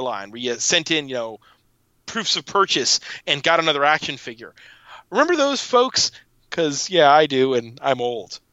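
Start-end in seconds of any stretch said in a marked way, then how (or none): 5.1-6.1 playful high question. Acted. Nostalgic aside to listeners.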